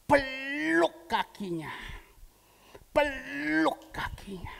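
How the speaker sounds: each word stretched like a gentle command; slow pleading repeat; slow urging. Retold or imitated. God's command retold, drawn out.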